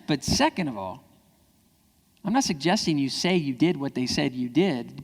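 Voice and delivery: ordinary talk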